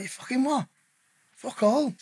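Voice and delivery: high, incredulous outburst at being jailed; retold outraged disbelief. Retelling his reaction to the sentence.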